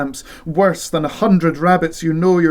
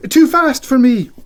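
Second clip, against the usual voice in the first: loud excuse; 10 semitones higher, 7 dB louder than usual. Acted. Husband shouts his excuse.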